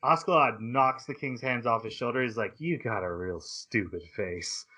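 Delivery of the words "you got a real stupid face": lower, softer, contemptuous line; low sneer at the king; mock menace. Askeladd insulting the king.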